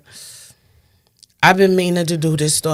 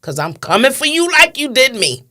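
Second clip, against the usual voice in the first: much higher and louder, reliving the yell; retold scream; 11 semitones higher, 7 dB louder than usual. Mother screaming at her son's killer in the cop car, much higher and louder. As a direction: scream it at him as a vow. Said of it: "And I screamed that".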